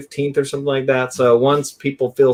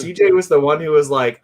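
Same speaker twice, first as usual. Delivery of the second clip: normal talking voice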